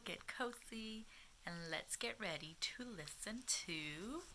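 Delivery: ordinary delivery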